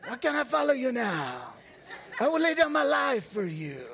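put-on high, loud Nacho Libre Mexican accent. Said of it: "I had a Nacho Libre accent".

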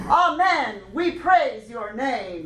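slow, bold declaration to a congregation; bold proclamation. Retold or imitated. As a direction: proclaim it really boldly. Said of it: "we spoke the translation really, really boldly".

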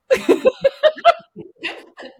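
high, loud burst of real laughter. Real, not acted. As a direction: break into a big laugh at her protest. Laughing at friend's hesitation.

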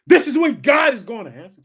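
preacher shouting the climax. Real, not acted. Preacher shouting to the congregation, full shout.

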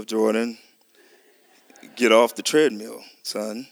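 low, firm sergeant's order to his son; a hint of a drill sergeant calling his boy. A father low and firm, ordering his son off the treadmill. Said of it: "my voice was a little".

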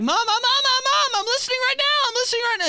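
put-on high, loud squealed child's protest; frantic mock pleading. Imitating self as squirming kid to mom, frantic squeal. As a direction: squeal desperately to make it stop.

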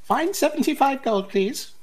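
very high character voice; haggling seller. Acted. Seller asking the buyer for payment, high voice. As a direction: settle the deal in a high-pitched voice.

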